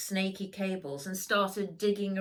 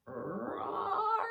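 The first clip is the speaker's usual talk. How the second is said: high droning bagpipe roar; silly held bagpipe wail; grumbling bagpipes. Acted. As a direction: make the pipes groan like a beast. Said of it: "grumbled the bagpipes".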